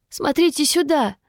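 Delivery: high-pitched cry at normal volume; panicky squeal. A narrator's reading. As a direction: squeal it in alarm, without shouting. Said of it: "заверещал один из учёных".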